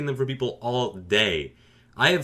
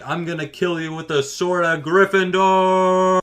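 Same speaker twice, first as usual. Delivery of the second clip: put-on higher, slow, pompous kid voice; kid hero threat; 7 semitones higher, 5 dB louder, slower than usual. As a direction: play a little boy declaring his big threat. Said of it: "it's just like".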